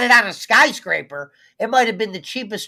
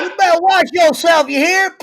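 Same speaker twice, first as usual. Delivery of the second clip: higher, louder mock Southern threat; southern cartoon villain; 8 semitones higher, 6 dB louder than usual. Joking after cartoon talk, hammed-up Southern threat. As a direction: ham up a cartoon Southern warning. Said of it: "how racist were those cartoons".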